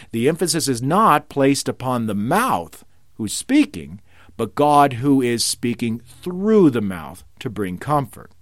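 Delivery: ordinary delivery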